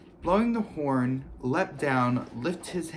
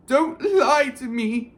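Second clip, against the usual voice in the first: higher, louder and slow, tearful; tearful gasp; 12 semitones higher, 7 dB louder, slower than usual. A narrator's reading. Myrtle to Hermione, tearful and hurt. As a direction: choke it out near tears. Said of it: "Myrtle gasped".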